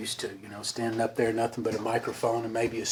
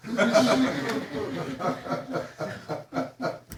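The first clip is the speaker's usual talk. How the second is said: laughing; 9 semitones higher, 7 dB quieter than usual. Real, not acted. Laughs at punchline.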